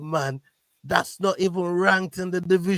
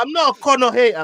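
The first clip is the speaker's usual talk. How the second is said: mock Irish brogue, pitched up and louder; Irish accent; 9 semitones higher, 6 dB louder than usual. S0 mimics Conor for his co-hosts, in Irish accent. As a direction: put on an Irish lilt like Conor. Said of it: "Is that an Irish accent from a South African?".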